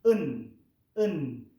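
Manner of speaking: en en said loud and clear; suffix demo. Shown as a demonstration. Teacher drilling the suffix en for students, loud and deliberate. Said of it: "Y el sufijo".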